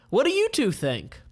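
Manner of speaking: high commander's question; Melek's voice. Acted. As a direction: turn to the hired pair. Melek character voice.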